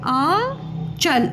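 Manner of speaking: slow sounding out. Shown as a demonstration. Teacher sounding out a word.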